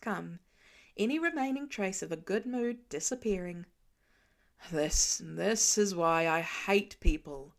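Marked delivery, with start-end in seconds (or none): 4.7-7.5 low grumbled line, said half under the breath; a lightly voiced grumpy Tony muttering. A narrator's reading. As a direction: grumble it to yourself, annoyed. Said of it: "he mutters to himself".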